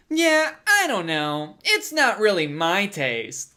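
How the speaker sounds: slow, drawling mock nonchalance; douchey rich guy. Rich guy dismissing his nice house, douchey drawl. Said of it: "that's kinda just douchey".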